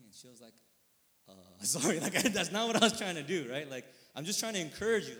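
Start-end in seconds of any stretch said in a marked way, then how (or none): 1.3-5.2 loud, higher, laughing imitation of a backpedalling wife; flustered apologetic wife. Wife consoling crying husband, flustered mock apology. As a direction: play a wife hastily backpedalling, half laughing.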